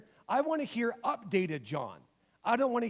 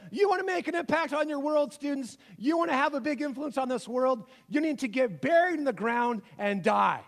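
lightly acted commencement speaker, pitched up; Jesus giving graduation speech; 5 semitones higher, 4 dB louder than usual. Jesus invited up to address graduating students, mock commencement speech. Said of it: "You invite Jesus up there. He says".

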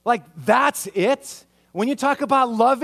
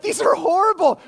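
put-on much higher, louder teenage complaint; whiny teen protest; 12 semitones higher, 5 dB louder than usual. Teenager complaining to parents about curfew, loud whine. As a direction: wail it like a teen handed a curfew. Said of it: "No, I was like".

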